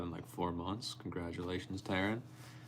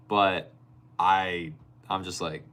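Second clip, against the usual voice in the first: normal talking voice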